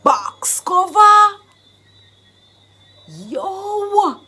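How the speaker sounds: slow, high, loud stretched outburst; acted, whooping excitement; excited exclamation. Shown as a demonstration. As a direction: draw it out in loud amazement. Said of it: "And it's all excited".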